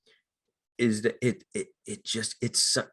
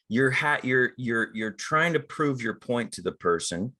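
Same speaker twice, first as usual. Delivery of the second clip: normal talking voice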